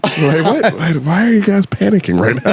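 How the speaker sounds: high-pitched, incredulous question; puzzled outsider, half laughing; mock bafflement. Outsider asking panicked banks what is wrong, mock bafflement.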